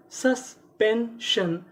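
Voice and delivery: very slow "suspension", each syllable apart. Shown as a demonstration. Teacher builds the word for learners, slowly syllable by syllable. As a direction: split "suspension" into three separate beats.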